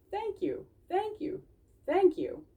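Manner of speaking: very high, tiny voices, thankful; squeaky chorus of thanks; grateful critters. Acted. The little animals thanking Dawn for the leaf. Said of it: "all said".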